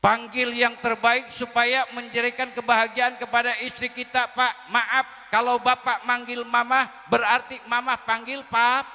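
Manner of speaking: high, loud sermon exhortation; preaching at full force. Acted. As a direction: urge the husbands from the pulpit. (ceramah lantang penuh semangat)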